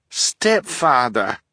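slow, drawn-out blushing stepfather; a shy young girl's mortified cry; embarrassed protest. A narrator's reading. Fiona embarrassed by her stepfather's marriage talk, protesting slowly. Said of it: "Fiona cried".

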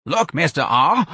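high, straining lifter; effortful, drawn-out appeal; breathless. A narrator's reading. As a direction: plead while out of breath. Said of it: "a lifter said breathlessly".

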